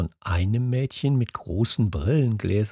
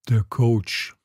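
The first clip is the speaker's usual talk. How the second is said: ordinary delivery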